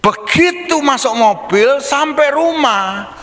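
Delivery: put-on high child's voice telling on parents; a kid exposing what happens at home; tattling child. Anak mengadu orang tua bertengkar. (anak mengadu, suara tinggi)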